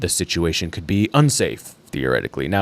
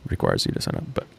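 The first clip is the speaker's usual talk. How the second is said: whispered aside; 7 dB quieter, faster than usual. Real, not acted. Aside while reading the article.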